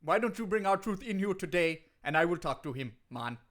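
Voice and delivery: lilting put-on Jamaican wrestler; hammed-up Jamaican accent; Kofi Kingston, Jamaican accent. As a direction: protest in a mock Jamaican accent. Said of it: "You talk funny now".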